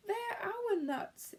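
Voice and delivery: high, slow, dismayed cry; dismayed exclaim. Acted. Bruce exclaiming at the bird that stole their nuts. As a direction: plead after the stolen cone. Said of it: "exclaimed Bruce".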